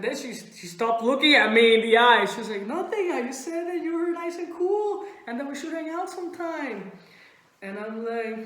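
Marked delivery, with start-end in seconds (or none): 2.7-6.9 high-pitched, defensive denial; scared friend. Retold or imitated. Scared friend explaining what she said, high and defensive. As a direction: imitate a nervous friend explaining herself. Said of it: "she was scared".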